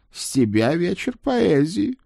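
high-pitched talking horse voice; a high, put-on horse voice, not whispered; talking horse's reminder. A narrator's reading. Horse reminds his rider of a promised poetry evening, high horse voice. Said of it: "тихо напомнил Центурион".

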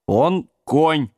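mildly stern confirmation. A narrator's reading. Jack and Sam confirm to giant, stern. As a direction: back him up firmly. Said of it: "строго подтвердили Джек и Сэм".

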